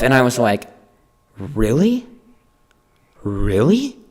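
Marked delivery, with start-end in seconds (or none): 1.4-4.0 drawn-out rising question, full of disbelief; incredulous. Retold or imitated. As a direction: act out doubting an accusation. Retold disbelief at the accusation.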